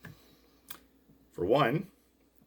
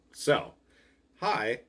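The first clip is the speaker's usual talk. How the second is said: ordinary delivery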